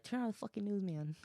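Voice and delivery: high-pitched comedian impression; Bill Burr. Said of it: "that's my Bill Burr voice".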